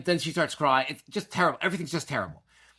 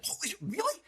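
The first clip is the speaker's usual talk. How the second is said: very high, softer imitated surprise; amazed disbelief; 6 dB quieter than usual. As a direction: imitate a kid who can't believe the news. Imitating woken son's surprise.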